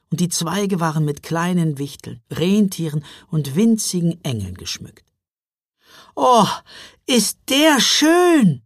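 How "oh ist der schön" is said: high, loud, drawn-out rapture; delighted exclamation. A narrator's reading. Girl admiring the huge Christmas tree, crying out delightedly. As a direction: cry out slowly in delight. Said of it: "rief Mila und klatschte begeistert".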